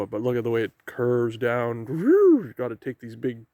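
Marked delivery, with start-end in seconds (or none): 1.9-2.4 a sudden high, loud whoop; excited woo. Real, not acted. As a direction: let it burst out as the slope drops away. Descending steep trail, exclaims.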